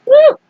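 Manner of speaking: high, loud whoop; woo. Real, not acted. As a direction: whoop as if thrown yourself. Narrator reacts to the flinging.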